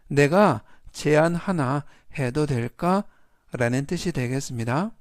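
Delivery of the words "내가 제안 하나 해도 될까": ordinary talk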